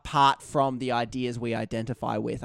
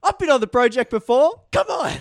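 much higher, much louder protest; indignant outburst; 10 semitones higher, 8 dB louder than usual. Acted. Ranting about a Sydney doctor, high and loud mock outrage.

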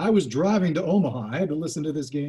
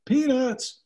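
stadium vendor; 9 semitones higher than usual. Retold or imitated. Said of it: "they yelled".

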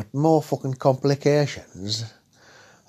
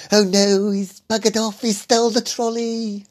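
high-pitched panicked game show host; Dale Winton screaming; 10 semitones higher than usual. Retold or imitated. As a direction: shriek it like a frantic TV presenter. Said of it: "I could hear Dale Winton in background screaming".

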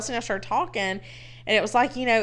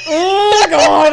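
higher, louder laughing oh God; laughing groan; 9 semitones higher, 11 dB louder than usual. Retold or imitated. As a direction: burst out in embarrassed laughter. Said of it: "And I'm like".

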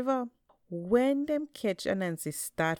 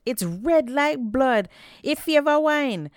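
exclaimed; 9 semitones higher, 8 dB louder than usual. A narrator's reading. Man in the crowd marvels at the red pot, loud amazed exclaim. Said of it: "one man exclaimed".

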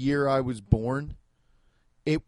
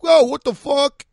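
much higher and louder shocked yell; loud startled outcry; 11 semitones higher, 9 dB louder than usual. Retold or imitated. New York guy on the street reacting, loud shocked yell. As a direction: blurt it out like you just got startled. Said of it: "he's like".

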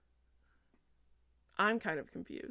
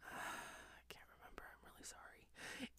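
very soft whispered aside; whispered apology; 22 dB quieter than usual. Real, not acted. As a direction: whisper it apologetically, barely audible. Apologizes for forgetting the name.

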